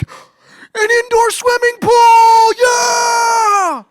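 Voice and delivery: high, loud, drawn-out gleeful shout; excited little kid. Retold or imitated. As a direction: yell it out like a thrilled six-year-old. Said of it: "My son got really excited".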